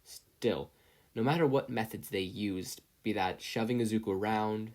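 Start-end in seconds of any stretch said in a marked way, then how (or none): none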